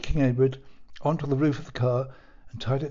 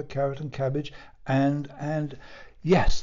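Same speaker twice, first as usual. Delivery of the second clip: usual speaking voice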